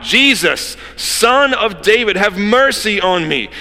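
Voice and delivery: high, loud, slow desperate cry. Acted. Blind beggar crying out to Jesus, loud slow pleading cry. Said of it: "he cried out".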